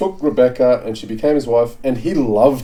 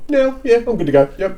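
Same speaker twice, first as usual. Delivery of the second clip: lightly raised eager Rebekah; high eager girl voice; 10 semitones higher than usual. Retold or imitated. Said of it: "Rebekah voiced eagerly".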